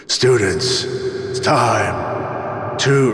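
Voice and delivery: slow, drawn-out lecturer voice; stern professor impression. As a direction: stretch it like a stiff professor. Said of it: "He was like".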